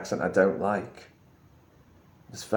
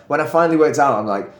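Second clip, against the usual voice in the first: ordinary talk, nothing marked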